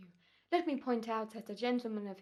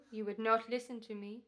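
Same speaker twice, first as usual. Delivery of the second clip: normal talking voice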